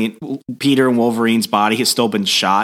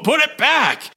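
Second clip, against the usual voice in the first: very high-pitched outraged yelp; hammed-up desperate order; mock frantic demand. Swapped hero demanding Jean undo it, mock frantic yelp. Acted demand to switch back.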